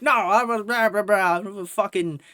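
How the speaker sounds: screaming braggart Karen. Retold or imitated. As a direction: imitate a self-important rant with blah-blah filler. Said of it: "just scream this shit in public".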